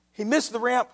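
usual speaking voice

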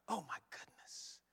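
whispered "Oh, my goodness" in awe; awed whisper. Real, not acted. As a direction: breathe "Oh, my goodness" as if overwhelmed. Repeated exclamation, whispered.

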